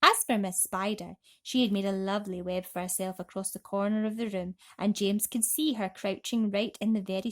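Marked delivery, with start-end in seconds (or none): none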